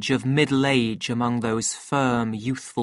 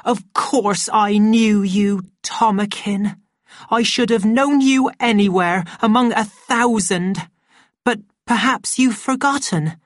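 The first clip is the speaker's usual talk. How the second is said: high, loud woman's character voice; impassioned high cry to a lost lover; Linda, emotional and pleading; 9 semitones higher, 6 dB louder than usual. Acted. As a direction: plead for recognition, pitch climbing.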